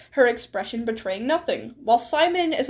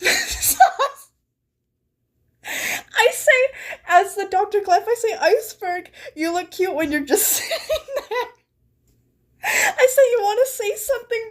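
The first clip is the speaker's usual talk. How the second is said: laughing hard; 7 semitones higher than usual. Real, not acted. Streamer typing a joke as Clef, laughing. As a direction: squeak the line out through giggles. Laughing at own flirt line.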